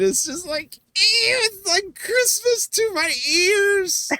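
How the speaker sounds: high-pitched, drawn-out mock ecstasy; gushing delight. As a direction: squeal it slowly as if overjoyed. Gushing over her odd pronunciation.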